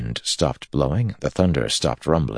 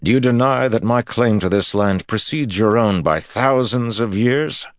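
loud, high commanding challenge; demanding; 9 semitones higher, 5 dB louder than usual. Acted. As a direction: press the claim, loud and forceful. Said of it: "Rand demanded".

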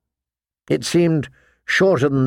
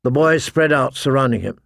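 ordinary delivery

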